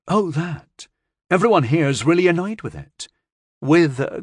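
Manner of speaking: a hint of an eager girl; lightly voiced girl, hurrying to reassure; lightly voiced young girl. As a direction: speak like a chatty young girl. Child Jay speaks.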